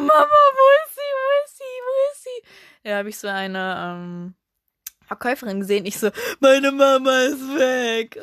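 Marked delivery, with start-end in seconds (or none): 0.0-2.4 put-on high, loud tearful child wailing; crying child. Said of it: "Ich hab so geheult wieder". 6.4-8.2 put-on slow, louder child voice; lost little girl. Verlorenes Kind zur Verkäuferin.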